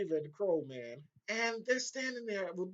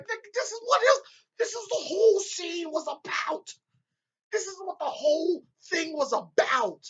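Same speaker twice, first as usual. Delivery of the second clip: much higher and louder exasperated yell; furious rant; 10 semitones higher, 5 dB louder than usual. Retold or imitated. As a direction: vent at the screen, fed up. Ranting at the show's choice.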